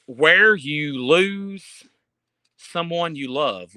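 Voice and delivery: very slow, drawn-out reading of "Where You Lose"; slow misread title. Real, not acted. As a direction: stretch "Where" out slowly while puzzling over the title. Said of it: "When You Lose Someone You Love".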